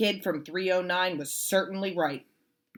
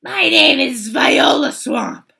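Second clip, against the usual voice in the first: loud, slow scratchy menace; scratchy witch voice; 8 semitones higher, 15 dB louder than usual. Acted. Viola Swamp introducing herself to the class, scratchy and slow. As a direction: rasp it out like a witchy teacher. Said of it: "said the lady in a scratchy voice".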